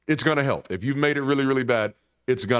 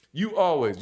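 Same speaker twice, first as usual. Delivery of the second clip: "You always" said higher and louder, accusing; accusing snap; 6 semitones higher, 5 dB louder than usual. Shown as a demonstration. Catching a slip in an argument, accusing "You always". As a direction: fling "You always" at your partner. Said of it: "So I'll catch myself now when I do it".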